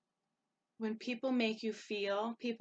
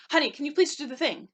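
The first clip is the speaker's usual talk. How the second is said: curt, loud, rushed request, pitched up; short demand; 5 semitones higher, 9 dB louder, much faster than usual. Retold or imitated. Stressed wife rushing kids, snapping at husband, curt and loud. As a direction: snap a hurried favor at him. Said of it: "sometimes we're gonna be short".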